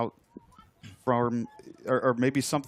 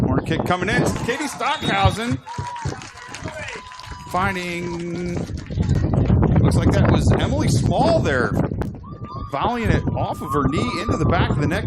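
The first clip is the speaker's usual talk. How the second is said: excited goal call; 9 semitones higher, 8 dB louder than usual. Real, not acted. Goal scored in live commentary.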